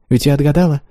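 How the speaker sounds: a sly smiling guess. Acted. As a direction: guess his secret with a playful smirk. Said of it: "она лукаво улыбнулась".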